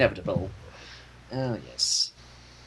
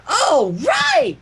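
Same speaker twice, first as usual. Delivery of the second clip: much higher excited squeal; squealing Pinky; 4 dB louder than usual. Acted. Pinky squealing as she bounds off, much higher. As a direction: squeal it with delight. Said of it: "Pinky squealed".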